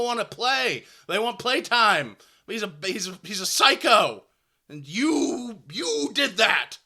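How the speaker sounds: put-on teen player venting at the coach, shouting; imagined furious outburst. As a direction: yell what you never dared say. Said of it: "what I wanted to say was".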